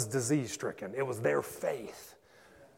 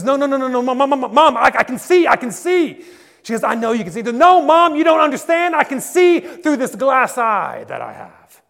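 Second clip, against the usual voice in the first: much higher and much louder, slowing at the end; excited child's shout; 12 semitones higher, 19 dB louder than usual. Acted. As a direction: insist to Mom, bursting with amazement. Boy insists he can see.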